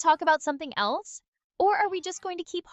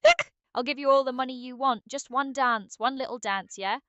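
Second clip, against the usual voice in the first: increasingly aggressive [name]; 3 dB louder than usual. Acted. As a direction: start wheedling in British slang, erupt into shouted orders. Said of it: "you get increasingly more aggressive as you go on".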